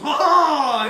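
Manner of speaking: loud, high triumphant "ha ha"; hammed-up heroic cry; swashbuckler's laugh. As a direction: announce yourself with a big theatrical laugh. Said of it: "and goes".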